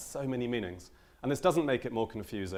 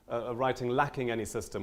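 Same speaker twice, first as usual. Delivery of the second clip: ordinary talk, nothing marked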